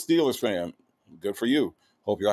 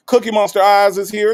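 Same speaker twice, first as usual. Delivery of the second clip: hype shout-out; 7 semitones higher, 8 dB louder than usual. Real, not acted. Hyping a viewer's name.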